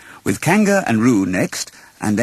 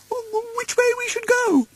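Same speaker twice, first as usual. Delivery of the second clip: very high, stammering little pig; nervous Piglet. Acted. Piglet frets about lost Roo, high and nervous. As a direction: falter it out like a scared piglet. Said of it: "Piglet said nervously".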